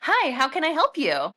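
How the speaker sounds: sweet receptionist greeting; phone voice. Shown as a demonstration. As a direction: put on the pleasant phone voice. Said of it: "phone voice choice comes out".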